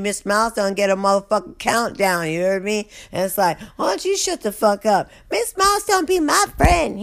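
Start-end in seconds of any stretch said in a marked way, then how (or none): 3.8-7.0 much higher, mocking squabble; bickering inmates. Inmates trading insults during the head count, high mock squabble. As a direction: sass each other in a high put-on voice.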